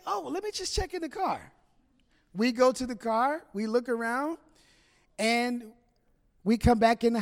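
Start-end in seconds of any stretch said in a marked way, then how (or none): 0.1-1.5 high, soft sheepish husband. Retold or imitated. Husband dodging his wife, soft and sheepish. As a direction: cover the slip, quietly. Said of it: "And we say".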